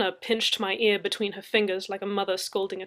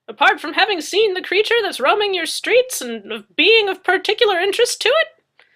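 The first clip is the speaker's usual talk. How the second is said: high, loud needling retort; Madzeke's voice; 11 semitones higher, 14 dB louder than usual. Acted. Madzeke presses Murphy, high and loud.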